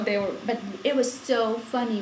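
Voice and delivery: normal talking voice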